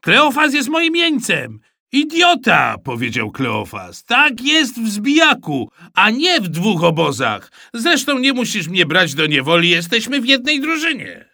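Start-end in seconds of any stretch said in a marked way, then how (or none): 0.0-1.6 high, loud excited claim; loud boast. A narrator's reading. Boy claiming his prisoner, calling out excitedly. 1.9-2.8 high, loud indignant outburst; angry insult. A narrator's reading. Hit boy insulting the thrower, angry. 4.0-11.3 put-on high, loud boyish protest; indignant arguing. A narrator's reading. Boy disputing the rules with classmate, indignant. Boys quarrel over game rules.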